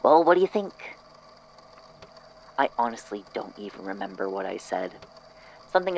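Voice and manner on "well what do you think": lightly voiced cheerful host, a bit higher and louder; a hint of an eager craftsman showing off his work. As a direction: ask it brightly, pleased with himself. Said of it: "Corrales clapped his hands and said".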